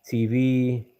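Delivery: ordinary talk, nothing marked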